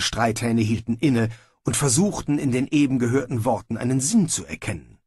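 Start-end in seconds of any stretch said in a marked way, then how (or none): none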